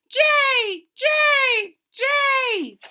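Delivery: very high, loud jay call; bird cry. Acted. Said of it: "the bird who cries".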